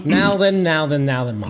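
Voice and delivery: mock Savile catchphrase voice; light Savile impression, teasing; Jimmy Savile impression. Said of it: "I thought it was Jimmy Savile".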